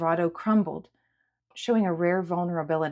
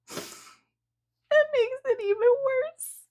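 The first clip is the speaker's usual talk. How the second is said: very high, slow, wailing sob; sobbing; much slower than usual. A narrator's reading. Sierra sobbing against Julia's kindness. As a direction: wail it out through tears. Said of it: "as she sobbed more".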